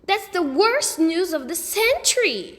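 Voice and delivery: high, loud dramatic outcry; dismayed cry. A narrator's reading. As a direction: wail it like a tragedy. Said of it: "Sheila cried".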